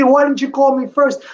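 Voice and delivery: high-pitched retold angry demand; mad wife. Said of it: "She was so mad".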